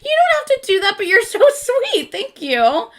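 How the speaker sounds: high-pitched, gushing thanks with a little laugh; touched gratitude. Real, not acted. Streamer to a generous viewer, touched and gushing. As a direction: gush at someone who has been too sweet.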